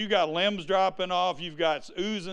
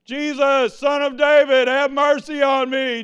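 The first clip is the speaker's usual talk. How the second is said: raised, higher calling out; blind man's cry; 7 semitones higher, 8 dB louder than usual. Acted. Blind Bartimaeus calling to Jesus, loud higher cry. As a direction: call across the crowd for mercy. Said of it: "The one that was screaming".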